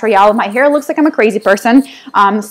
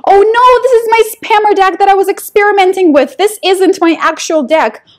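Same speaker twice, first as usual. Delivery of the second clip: higher, fast alarmed outburst; 7 semitones higher than usual. Real, not acted. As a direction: panic at the wrong deck. Realises wrong deck.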